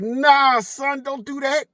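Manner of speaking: imitating his friend warning. Friend Mike warning him off the girl, high and slow. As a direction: drag out the friend's teasing no. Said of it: "And he was like".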